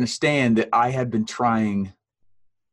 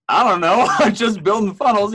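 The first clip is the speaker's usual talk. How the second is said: words broken by real laughter, pitched higher; laughing; 10 semitones higher, 5 dB louder than usual. Real, not acted. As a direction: giggle through the bashful reply. Laughing while retelling reply.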